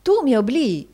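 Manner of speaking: high, loud reproach; wife's dramatic protest; exclaiming. A narrator's reading. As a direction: accuse him with wounded indignation. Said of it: "esclamò Madama".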